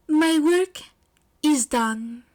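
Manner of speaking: bow out like a hero; grand exit line. Acted. Aoyama departs grinning.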